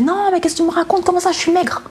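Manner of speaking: high, fast indignant protest, pitched well up; irritated denial. Retold or imitated. Denying to her mother she is too thin, high indignant protest. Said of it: "Et je m'énervais".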